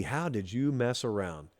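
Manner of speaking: low, slow, softened question; slow, quiet dismay at a rival; grave reproach. Acted. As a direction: scold in a hushed, drawn-out low voice. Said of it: "we were talking to the rest of the S E C".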